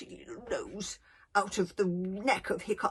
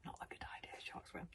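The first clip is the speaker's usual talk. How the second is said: very soft, near whisper; fearful hush; 19 dB quieter, faster than usual. Acted. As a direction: say it timidly under your breath. Frightened Fishlegs, barely audible.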